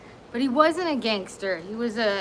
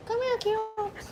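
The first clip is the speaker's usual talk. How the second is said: high, sing-song pet voice; 11 semitones higher, 5 dB quieter than usual. Real, not acted. Calling the guest dog.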